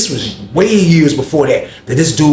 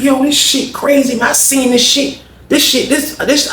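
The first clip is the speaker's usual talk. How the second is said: retold amazement; 11 semitones higher than usual. Narrator reacting to shocking video.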